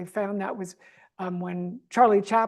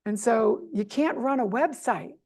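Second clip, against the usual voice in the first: normal talking voice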